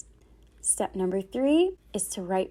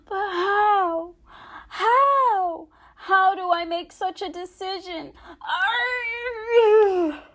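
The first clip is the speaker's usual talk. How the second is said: high, loud anguished crying; despairing wail; 10 semitones higher, 5 dB louder, slower than usual. Acted. Boy alone before the wedding, wailing in despair. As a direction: break into a desperate groan. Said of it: "Jameson sank in despair".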